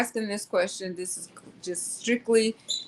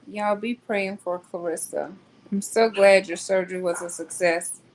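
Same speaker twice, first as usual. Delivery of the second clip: ordinary talk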